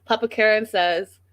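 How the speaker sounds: ordinary talk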